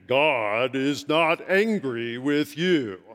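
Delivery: a hint of a bass-voiced professor; imitating a deep voice. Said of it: "He had a very deep voice".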